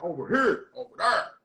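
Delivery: "here" and "there" with heavy local r, "hurr" and "thurr"; north side pronunciation. Shown as a demonstration. Showing how the north side says it, "here" and "there" with heavy r. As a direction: round "here" and "there" into a thick r. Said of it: "we be like".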